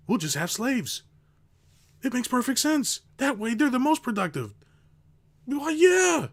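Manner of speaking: high, smug boss voice; mocking a greedy boss, pitched high. Mocking greedy bosses' mindset.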